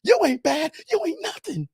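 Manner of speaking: a mock falsetto pop-star call. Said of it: "Y'all remember that?".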